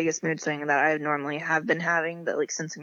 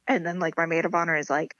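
ordinary talk, nothing marked

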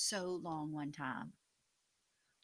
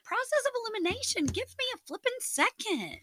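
exasperated; 5 dB louder than usual. Real, not acted. Flustered under pressure.

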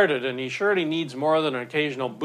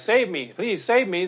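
higher-pitched acted plea for rescue; lightly acted drowning man's cry; 5 semitones higher, 3 dB louder than usual. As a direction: call for help as the swimmer. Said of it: "he cries".

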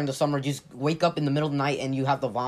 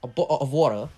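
bo'o' of water with dropped t's in a clumsy accent; amateur British accent on bottle of water; bad British-style accent. Shown as a demonstration. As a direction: attempt a glottal-stop British accent. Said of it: "I'm bad at accents".

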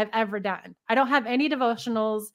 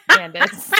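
teasing; 9 semitones higher, 9 dB louder than usual. Acted. Teasing threat to friend.

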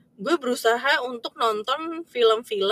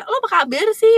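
"Lo apa kabar sih?" pitched much higher and louder, lilting; native question tune; 11 semitones higher, 7 dB louder than usual. Shown as a demonstration. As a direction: show off the foreign question melody, high and bright in pitch. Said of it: "Intonasi mereka nanya kayak".

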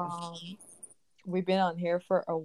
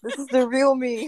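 laughing; 3 semitones higher, 8 dB louder than usual. Real, not acted. Teased on the podcast about her accent, laughing. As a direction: laugh through the words, a little louder.

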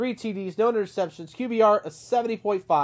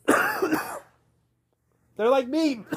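coughing fit mid sentence; choking on a drink; 7 semitones higher, much slower than usual. Real, not acted. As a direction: sputter and cough through it. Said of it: "Excuse me".

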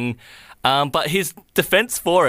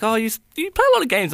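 lightly voiced mum, higher and quick; imitating mum; 11 semitones higher, faster than usual. Mum remarking on his gaming at home, imitated in a higher, quick voice. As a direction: raise the pitch for a quick mum quote. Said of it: "my mum will be like".

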